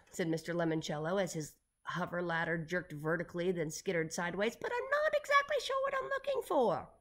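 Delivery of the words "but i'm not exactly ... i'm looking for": very high, strained character voice; high voice dropping off at the end; high-pitched call. A narrator's reading. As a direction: pitch it way up as if jolted aloft. Eccentric boss calls from jerking ladder.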